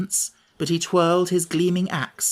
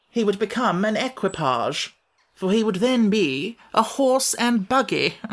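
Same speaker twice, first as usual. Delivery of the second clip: pompous Wogglebug; 5 semitones higher than usual. Acted. Wogglebug lecturing Tip, pompous and higher. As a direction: boast like a puffed-up scholar.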